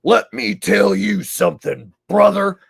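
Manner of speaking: high, loud wrestler promo voice; Hulk Hogan. Retold or imitated. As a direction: belt it out like a showboating wrestler. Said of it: "So Hulk Hogan comes out at one point".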